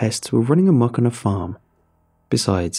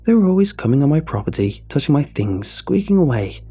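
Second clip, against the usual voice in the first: put-on high, rambling old woman voice; exclaiming old lady; 7 semitones higher than usual. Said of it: "Missus Kettlewell exclaimed".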